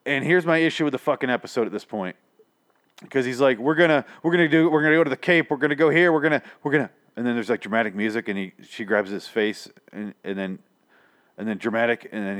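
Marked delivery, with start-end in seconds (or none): 3.6-6.9 excited planning. Retold or imitated. Retold character frantically listing plans.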